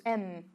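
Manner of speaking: M held with lips shut; M with lips pressed together; lips closed M. Shown as a demonstration. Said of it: "a gente sempre fecha nossos lábios juntos".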